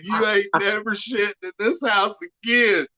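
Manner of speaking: high-pitched mock telling-off; hammed-up outraged woman banning him; imitated scolding. Acted scolding from woman of house.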